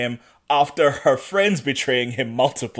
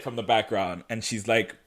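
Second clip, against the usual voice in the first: ordinary talk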